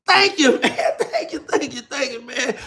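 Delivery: high, laughing thank-yous that settle down. Real, not acted. Thanking a chat viewer for a compliment, laughing and flattered. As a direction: chuckle your thanks, flattered.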